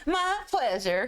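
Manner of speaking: high, slow mock service reply; customer service catchphrase. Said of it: "you're always gonna get".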